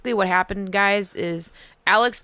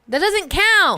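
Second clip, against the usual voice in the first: higher, louder whiny protest; indignant protest; 12 semitones higher, 5 dB louder, much slower than usual. Real, not acted. Cohost disputes the cuss count, indignant whine. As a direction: insist it doesn't count.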